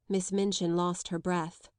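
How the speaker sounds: ordinary delivery, nothing marked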